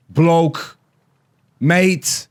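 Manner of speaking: isolated words in bad British accent; Ricky Gervais. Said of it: "I can't do a British accent".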